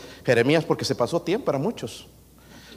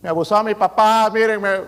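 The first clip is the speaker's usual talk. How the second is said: put-on whiny child accusing father, higher and louder; child complaining to police; 7 semitones higher, 5 dB louder than usual. Child denouncing father to the police, whiny and raised. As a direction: play the kid tattling on dad. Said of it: "Te va a llamar a la policía".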